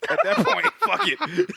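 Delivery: high-pitched, laughing through it; words squeezed out between laughs. Real, not acted. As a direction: give up in a fit of laughter. Laughing at his escape plan.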